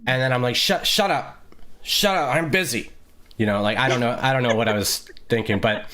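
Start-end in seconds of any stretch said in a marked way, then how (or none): 0.5-2.9 higher-pitched acted retort to an inner voice; mock brush-off. Telling the inner critic to shut up, mock dismissive. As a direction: play at shooing away a nagging voice.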